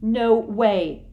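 loud defiant yell; Harry yelling at Hermione. A narrator's reading. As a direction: shout it back at her. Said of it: "yelled Harry".

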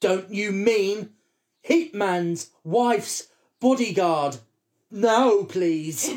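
slow, emphatic word-by-word query; hammed-up fussy ticket clerk; mock pedantic correction. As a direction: correct them with exaggerated precision.

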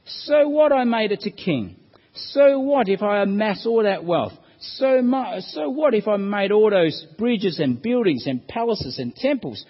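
loud, high exasperated outburst; acted exasperation, punching each "so what"; frustrated Solomon. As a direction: throw up your hands in frustration. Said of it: "you can sense Solomon's frustration here".

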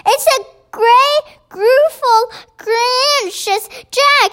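high-pitched panicked giant; bellowing in terror. A narrator's reading. Giant raves about Jack attacking him, bellowing. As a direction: wail each accusation, building to a shout on the name. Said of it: "bellowed the flesh lump eater".

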